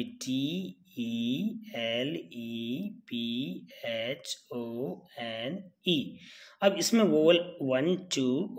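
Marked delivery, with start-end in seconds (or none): 0.0-6.2 slow, letter-by-letter spelling. Shown as a demonstration. Teacher writing a word for students, spelling slowly. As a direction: spell it out slowly, a gap after each letter. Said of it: "मैं एक शब्द लिखता हूँ".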